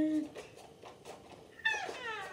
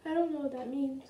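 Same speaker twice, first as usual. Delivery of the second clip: normal talking voice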